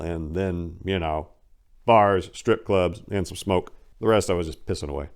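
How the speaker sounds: ordinary talk, nothing marked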